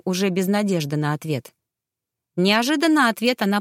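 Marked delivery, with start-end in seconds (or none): none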